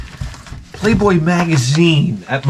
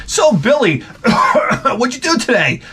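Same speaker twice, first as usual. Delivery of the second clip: higher, laughing mock mom; mom questioning; 7 semitones higher than usual. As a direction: tease it out like his mom through giggles. Voicing mom questioning Billy.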